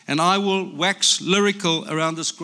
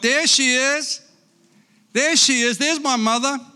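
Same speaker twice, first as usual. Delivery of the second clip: excited spotting; 9 semitones higher, 3 dB louder than usual. Acted. Baby bird spots the boat.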